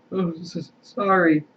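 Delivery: a high, broken sob through the apology; sobbed. A narrator's reading. Hagrid apologising to McGonagall, sobbing. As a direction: stammer it out between sobs. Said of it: "sobbed Hagrid".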